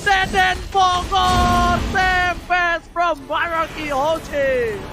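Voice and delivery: slow, drawn-out hype announcer call; fighting game announcer. Real, not acted. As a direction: stretch every word like an arena announcer. Caster calling the Savage kill.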